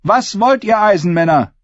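high-pitched, forceful oratory to a hall; defiant female claimant. Acted. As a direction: challenge the crowd like a contender for the crown. Asha rallies the assembly.

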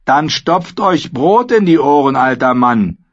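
insolent retort. A narrator's reading. Karl defies his Lord Commander, insolent retort. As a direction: throw it back in his face.

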